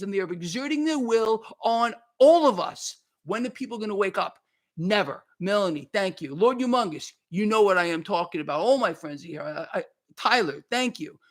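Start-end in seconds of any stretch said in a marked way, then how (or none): none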